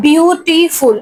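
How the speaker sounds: "Beautiful" broken into separate beats; syllable by syllable. Shown as a demonstration. Teacher showing many lip movements, "beautiful" said syllable by syllable. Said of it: "এটাতেও কিন্তু অনেকবার নড়ছে".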